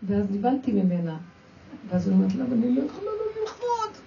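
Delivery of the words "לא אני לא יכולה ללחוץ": high, strained voice of a mother in labour; pleading that she cannot push; imitating the mother in labour. A mother in labour tells the midwife, in a high strained voice.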